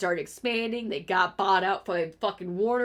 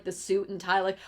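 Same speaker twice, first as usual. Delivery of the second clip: normal talking voice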